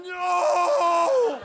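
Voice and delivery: high, loud shouted NO; orgasm scream.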